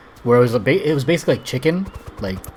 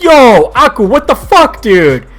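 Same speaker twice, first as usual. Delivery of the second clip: much higher, louder shout of outrage; dad yelling; 13 semitones higher, 12 dB louder, slower than usual. Retold or imitated. Dad yelling at his son after the ball hits the window, angry shout. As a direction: yell it like a furious dad who just got hit. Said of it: "he was like".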